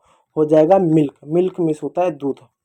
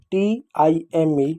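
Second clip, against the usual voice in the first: very slow letter-by-letter spelling; spelled out slowly; much slower than usual. Shown as a demonstration. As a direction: give each letter its own slow beat. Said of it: "इन शब्दों को पढ़ो". (धीरे-धीरे, एक-एक अक्षर बोलकर)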